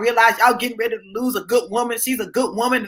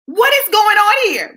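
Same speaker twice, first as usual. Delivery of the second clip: mock bewilderment; 10 semitones higher, 4 dB louder than usual. Exasperated comic exclamation.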